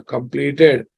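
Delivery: usual speaking voice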